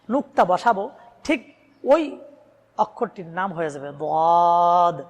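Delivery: usual speaking voice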